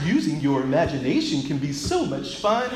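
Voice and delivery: cheerful purple dinosaur voice, laughing; sing-song kids' TV dinosaur; Barney voice. Retold or imitated. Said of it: "my best Barney the Dinosaur voice".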